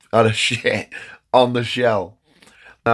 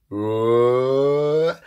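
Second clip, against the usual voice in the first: mock surprise; 4 semitones higher than usual. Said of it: "he goes".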